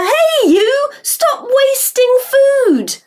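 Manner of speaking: high, slow call across the field; girl calling out, high and stretched. Acted. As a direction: shout to make him stop. Molly calls out to farmer.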